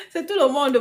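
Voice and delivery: loud and high through a laugh; real laughter. Real, not acted. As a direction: say it while cracking up. Still laughing, reassures viewer.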